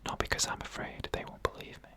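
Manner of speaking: plain reading voice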